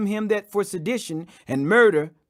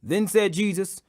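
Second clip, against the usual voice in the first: usual speaking voice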